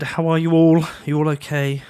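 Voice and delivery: usual speaking voice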